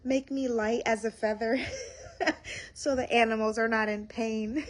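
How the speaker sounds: playful plea broken by real laughter; joking, laughing. Real, not acted. Joking with Jesus about riding animals, laughing. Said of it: "I started joking with him".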